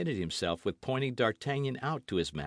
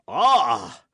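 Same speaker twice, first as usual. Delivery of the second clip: loud pained gasp as if shot; stricken cry; 3 semitones higher, 12 dB louder than usual. A narrator's reading. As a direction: cry out as though struck in the heart. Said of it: "cried the Musketeer".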